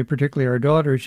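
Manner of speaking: usual speaking voice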